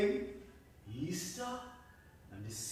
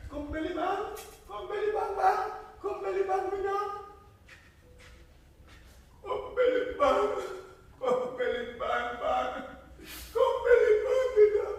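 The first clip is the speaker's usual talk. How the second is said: much higher, calling in rising panic; frantic calling; 7 dB louder than usual. Acted. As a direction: scream for the dogs. Desperate dog calls.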